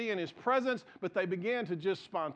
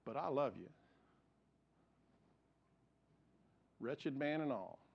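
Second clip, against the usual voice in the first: much softer, lower, gentle reassurance; tender voice of God; 5 semitones lower, 9 dB quieter than usual. Retold or imitated. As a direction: speak as a loving father, hushed. The Lord speaking to his heart.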